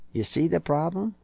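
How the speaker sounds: ordinary talk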